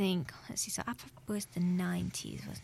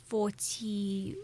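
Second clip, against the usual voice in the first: usual speaking voice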